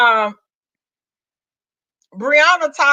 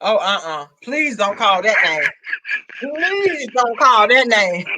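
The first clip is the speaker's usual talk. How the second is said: lower, dragged-out pleading; low mock plea; 4 semitones lower, slower than usual. Real, not acted. Begging Sarah not to say that name, low and slow. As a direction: beg them not to say it, slowly.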